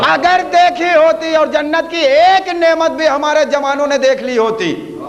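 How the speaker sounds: high, ringing call to the congregation; full-force preaching. Real, not acted. Preacher rallies the crowd. (بلند آواز میں پُرجوش خطابت)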